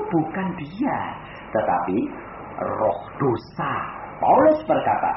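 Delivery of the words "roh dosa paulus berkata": usual speaking voice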